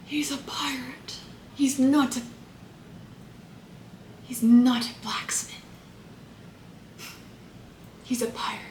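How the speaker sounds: a breathless mock movie line, half whispered; Keira Knightley impression. Vlogger hamming a pirate-film line as Keira Knightley, hushed. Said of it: "That's my Keira Knightley impression".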